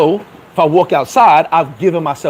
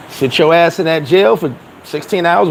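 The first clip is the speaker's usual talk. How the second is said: ordinary talk, nothing marked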